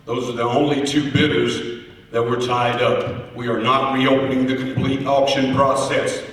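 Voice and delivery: usual speaking voice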